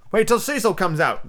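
high-voiced, grudging pirate; protesting pirate. A narrator's reading. Starkey holding out against the captain, high protest. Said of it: "growled Starkey".

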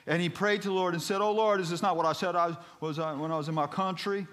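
high, loud Southern preacher reading scripture; Southern-accented pulpit plea. Acted. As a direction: proclaim the prayer with a Southern lilt. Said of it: "I don't know why I'm speaking Southern".

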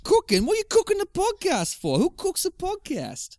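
very high, incredulous British squawk; British accent. Acted. Husband baffled by podcast cooking, incredulous British accent. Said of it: "I'm still going into the British accent".

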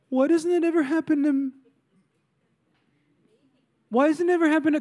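put-on "poor me" whine; whiny. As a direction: whine it like a sulking complainer. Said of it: "Are you whiny?".

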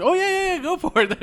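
high, loud, carefree guard; carefree soldier voice. Retold or imitated. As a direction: toss it off like you don't care.

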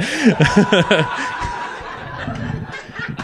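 breathy high-pitched giggle; a silent, wheezing fit of laughter. Real, not acted. Comedian cracking up at his own wife impression.

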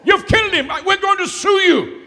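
high, loud panicked shout; doctor screaming accusations at the preacher. Retold or imitated. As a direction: yell it as an outraged doctor. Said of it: "the doctor jumped up and said".

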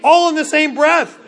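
ordinary talk

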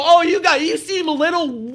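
hammed-up high sneer of a critic; mocking voice. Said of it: "People go".

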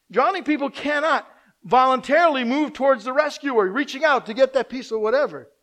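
proclaiming loudly from the pulpit; full-force preaching. Acted. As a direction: preach it out with fervour.

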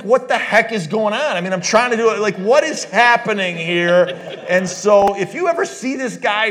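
loud, high, worked-up storytelling; excited retelling. Retold or imitated. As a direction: tell it like you still can't believe it. Wife walked down aisle by stranger.

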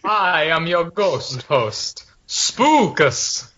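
slow, spooky ghost host. Pretend. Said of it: "do a spooky voice".

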